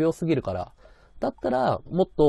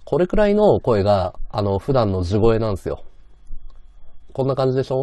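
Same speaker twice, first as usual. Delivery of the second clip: bright mic voice; 9 dB louder than usual. Shown as a demonstration.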